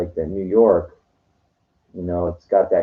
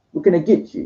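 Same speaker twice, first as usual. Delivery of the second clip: much higher, clipped counter shout; brusque deli guy; 9 semitones higher than usual. Retold or imitated. Deli worker barking at a customer, much higher impatient voice. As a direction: bark the order question like a New York deli man. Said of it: "You know, like this".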